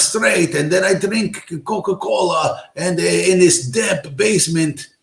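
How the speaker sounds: put-on high, slow East European hacker accent. Mocking a cartoon hacker, slow East European accent. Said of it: "a little East European guy with a cigarette".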